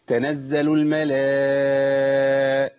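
slow chant, "الملا" sustained on one note; four-count madd. Shown as a demonstration. Teacher demonstrating madd length, "الملا" chanted very long. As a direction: hold the aa of "الملا" four full beats. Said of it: "على الأقل أربع حركات".

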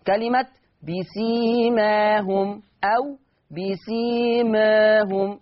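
بسيماهم slow, first open then tilted toward e; slow tajweed demo of بسيماهم both ways; fatḥ then taqlil. Shown as a demonstration. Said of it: "المقلل بخلف عنه،".

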